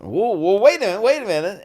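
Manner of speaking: rapid-fire mock indignation; retold protest. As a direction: act out being stung and halting her. Retells his protest at being needled.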